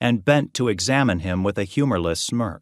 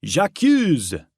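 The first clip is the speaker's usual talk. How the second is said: raised pointed accusation; French cry of accusation, lightly raised; mock accusing shout; 8 semitones higher than usual. A narrator's reading. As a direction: fling the charge dramatically.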